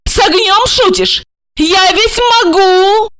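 very high, threatening woman; angry cry. A narrator's reading. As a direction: lash out in a high-pitched voice. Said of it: "оттолкнула его и закричала".

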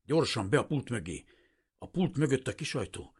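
high, urgent hurrying; tense, pressing order; urgent hushed panic. A narrator's reading. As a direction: hurry someone into hiding. Frightened man hurrying a fugitive inside.

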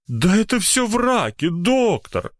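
high-pitched protest of innocence; indignant. A narrator's reading. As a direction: deny it with whining outrage. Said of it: "возмущённо произнёс Кузькин".

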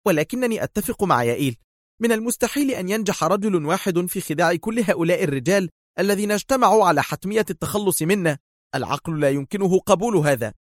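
high-pitched, edgy protest; nervous agitation. A narrator's reading. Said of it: "تقول في عصبية".

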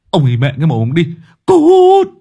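fast curse ending in a huge high scream; furious yell. Acted. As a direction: explode at the ghost. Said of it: "Kiên rú lên". (gào lên giận dữ)

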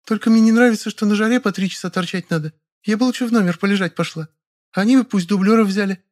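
put-on high, lazy, whiny girl voice. A narrator's reading. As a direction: whine about the heat. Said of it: "неожиданно добавила".

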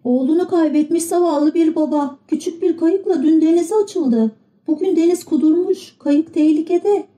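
put-on high, worried old woman. A narrator's reading. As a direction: tell of danger at sea. Old woman describes the boat.

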